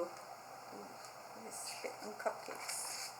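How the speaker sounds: hushed, shocked whisper; a mock-shocked whisper to the kids; whispered aside. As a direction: lean in and whisper the gossip.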